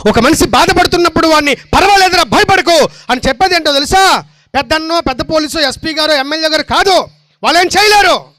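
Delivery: high, loud, ringing declaration of truth; fervent preaching. Acted. Preacher to the congregation, fervent loud preaching. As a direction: proclaim it to the whole church.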